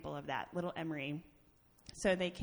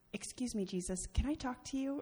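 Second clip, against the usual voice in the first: imitating a small child, sweetly polite and higher; politest little-girl voice; 6 semitones higher, 3 dB louder than usual. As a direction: lift the voice into a child's courteous request. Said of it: "in her politest voice".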